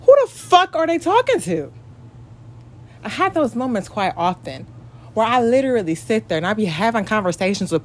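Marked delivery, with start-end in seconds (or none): 0.0-1.7 high-pitched incredulous outburst; indignant inner voice. Acted. Woman voicing what she says to herself, high and incredulous. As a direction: pitch it way up like your own mind protesting. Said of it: "where yourself say to yourself".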